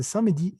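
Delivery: "samedi" in three syllables, sa-me-di; sounded E. Shown as a demonstration. Teacher showing how others say it, E sounded. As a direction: let the E slip into "samedi". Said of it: "se les patina la E".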